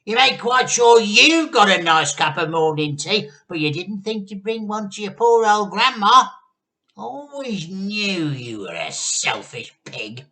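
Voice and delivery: put-on very high, very loud spiteful old hag; Grandma ranting. A narrator's reading. Grandma accuses George of selfishly hoarding tea. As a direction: harangue him, wounded and nasty.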